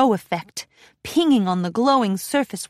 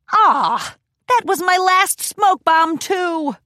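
higher, louder frustrated outburst; 8 semitones higher, 4 dB louder, slower than usual. A narrator's reading. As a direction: vent about the wasted trick. Frustrated at failed trick.

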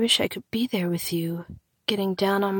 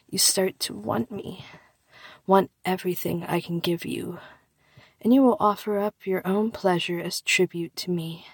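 ordinary talk

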